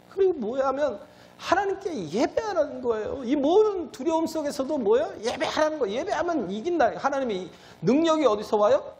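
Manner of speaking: high-pitched, driving sermon climax; fervent preaching. Real, not acted. Preacher calling the congregation to worship through fear, fervent.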